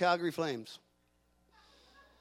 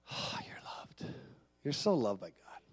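tender whisper; 8 semitones lower, 8 dB quieter than usual. Acted. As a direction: breathe it softly to each listener. Pastor hushes to speak God's love.

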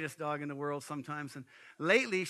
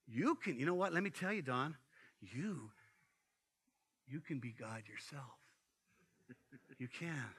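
soft tempting voice; 5 semitones lower, 8 dB quieter than usual. Acted. Satan tempting Don, continued.

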